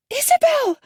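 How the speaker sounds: very high, loud scream of her name. A narrator's reading. Mother screaming to her daughter on the cliff. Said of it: "I scream".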